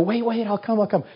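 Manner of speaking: high-pitched, eager scramble to agree; hurried pleading. Retold or imitated. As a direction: blurt it out as if chasing someone leaving. Retold hurried reply to God.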